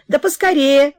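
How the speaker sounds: high, loud hurry-up; impatient mother. Acted. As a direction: urge him on loudly. (мать громко торопит)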